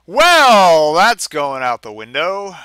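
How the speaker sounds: loud jokey "well" with the rest slowed down; mock loud exclaim. Reader jokes about throwing the game, loudly. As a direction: announce it like tossing it away. Game gets tossed, joking.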